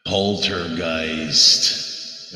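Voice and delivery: very slow, drawn-out spooky title with echo; spooky announcer voice. Shown as a demonstration. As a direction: stretch it out like a horror trailer. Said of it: "So let me do this here".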